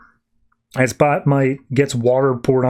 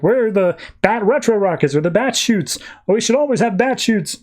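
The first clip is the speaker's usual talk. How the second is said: higher-pitched comic alarm; mock panicked complaint; 7 semitones higher than usual. Batmite goes off the edge, mock panic.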